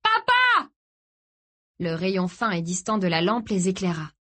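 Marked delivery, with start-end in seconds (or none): 0.0-0.7 very high, urgent scream; desperate high-pitched call; all-out shout. A narrator's reading. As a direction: yell it high with all your strength. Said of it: "cria-t-il de toutes ses forces".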